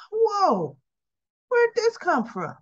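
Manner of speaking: high-pitched mock astonishment; Tracy, taken aback. Tracy blindsided by the invitation, astonished. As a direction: act out being stunned, voice lifted throughout.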